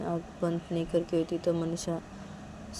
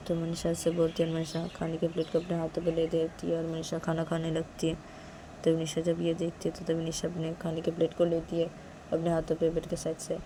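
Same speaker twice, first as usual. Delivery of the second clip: ordinary delivery, nothing marked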